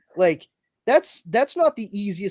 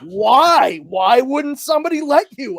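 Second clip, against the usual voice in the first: high, loud mock disbelief; mock bafflement; 7 semitones higher, 6 dB louder, slower than usual. Answering the director's Superman plea, mock baffled. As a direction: exclaim it in exaggerated, high-pitched wonder.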